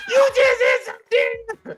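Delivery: high, laughing mock shout as his wife; hammed-up wife yelling while laughing. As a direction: squeal it out through laughter. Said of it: "And she go".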